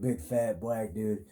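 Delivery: ordinary talk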